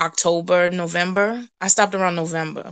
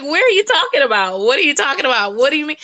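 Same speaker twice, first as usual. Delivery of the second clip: higher-pitched, incredulous questions in a row; baffled questioning; 7 semitones higher, faster than usual. Retold or imitated. Woman questioning the apostle's prophecy, baffled and incredulous. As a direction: press him, baffled and pitched up.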